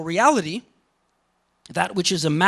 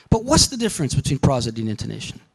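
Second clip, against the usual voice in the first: ordinary delivery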